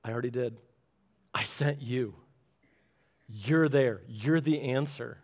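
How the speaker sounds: God gently pointing back at you; calm God reply. Retold or imitated. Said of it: "and he's like".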